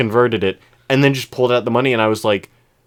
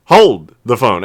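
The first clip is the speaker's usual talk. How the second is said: louder, sudden stop; loud double take; 10 dB louder, slower than usual. Retold or imitated. Cashier stunned by the customer's overpayment, loud double take. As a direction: halt everything in surprise.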